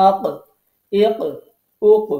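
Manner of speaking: আক, এক, ওক with the final ক bounced out in a short echo; তাজবীদ শিক্ষকের মতো আক, এক, ওক — শেষের ক ধাক্কা দিয়ে উচ্চারণ; qalqala bounce. Shown as a demonstration. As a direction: knock out the last ক of আক, এক, ওক so it rebounds. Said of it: "ধাক্কা খেয়ে অক্ষরটা উচ্চারণ হচ্ছে".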